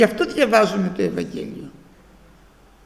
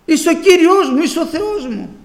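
higher, louder cry of joy; retold joyful shouting; 8 semitones higher, 6 dB louder than usual. Preacher recalling how he cried out as a boy, higher and louder. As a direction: call out to God with a child's joy. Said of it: "Ε, έτσι φώναζα".

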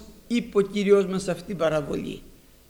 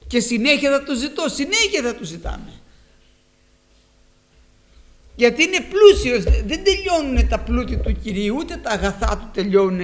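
louder, rising preacher's fervour; preacher building to a fervent, louder climax; fervent preaching; 3 semitones higher, 6 dB louder than usual. Real, not acted. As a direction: lift the voice in praise.

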